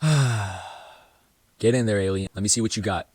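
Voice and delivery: deep, slow, gruff coach order; low coach voice. Retold or imitated. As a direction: drop low like a stern coach. Coach sends him in, low voice.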